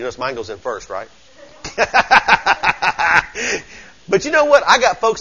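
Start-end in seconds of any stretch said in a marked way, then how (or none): 1.6-3.6 long chuckle in short bursts; laugh. Real, not acted. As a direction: laugh it out in a rattling string. Laughs at his own joke.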